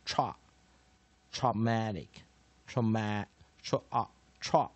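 very slow, drawn-out "tra", then traumatic piece by piece; sounded-out pronunciation. Shown as a demonstration. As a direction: stretch the first syllable "tra" of traumatic with a full vowel. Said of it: "而我们不会念". (慢慢拆开示范 tra、traumatic)

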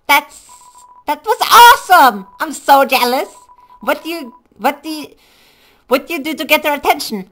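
high, loud, gushing cartoon kid; excited game character. Excited fan gushes to friend about her, high and loud. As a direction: gush in a squeaky, overexcited character voice. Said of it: "I think I got that voice".

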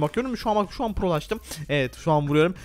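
ordinary talk

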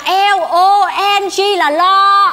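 slow, high, drawn-out letters of "L O N G"; slow singsong spelling, pitched high; exaggerated spelling out. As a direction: stretch every letter of "L O N G" as you repeat it. She repeats the corrected spelling.

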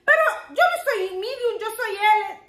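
high mock-offended insistence; imitating him refusing to be a medium; husband insisting he is an L, imitated indignant and high; Jay protesting. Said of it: "ese hombre estaba de enojado".

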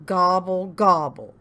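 loud grumbled gobble; grumbling turkey. A narrator's reading. Turkey rebuffed by cow, grumbling gobble. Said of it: "grumbled Turkey".

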